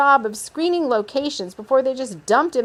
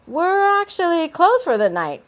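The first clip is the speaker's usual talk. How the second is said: high-voiced Kay; 8 semitones higher, 5 dB louder than usual. A narrator's reading. Kay tells visitor it's closed, high loud voice. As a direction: pitch it up and project.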